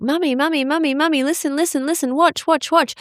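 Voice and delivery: put-on high, repetitive sing-song child voice; imitating pestering kids. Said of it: "They're like".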